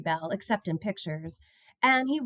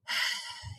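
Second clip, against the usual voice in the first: soft unvoiced sigh; 8 dB quieter than usual. A narrator's reading. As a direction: let out a long sad breath. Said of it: "He sighed".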